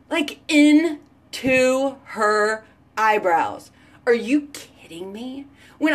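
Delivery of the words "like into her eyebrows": slow emphatic repeat. Real, not acted.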